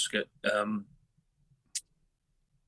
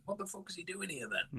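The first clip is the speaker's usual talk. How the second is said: frustrated; 6 semitones higher, 13 dB quieter than usual. Retold or imitated. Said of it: "I was frustrated".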